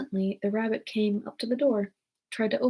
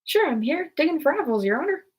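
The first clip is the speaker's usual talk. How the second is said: higher, louder put-on servant voice; lively higher-pitched reply to a superior; servant answering; 6 semitones higher, 4 dB louder than usual. A narrator's reading. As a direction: answer the master in a lilting character voice. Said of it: "a voice she had never heard before".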